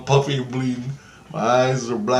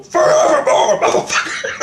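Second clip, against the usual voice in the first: high, loud yell breaking into a laugh; retold battle cry, full volume; triumphant shout; 6 dB louder than usual. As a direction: bellow it high at an enemy. Shouts command word at villain.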